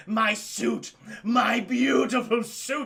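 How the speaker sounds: slow, loud hammed-up wail over a ruined suit; mock wail. Shocker wailing over his ruined suit, hammed-up. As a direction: wail it like a heartbroken villain.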